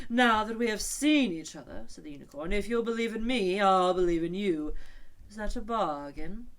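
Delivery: high, loud grand unicorn voice; a proud creature striking a deal; unicorn's bold offer. A narrator's reading. Unicorn proposing a bargain to Alice, loud and grand.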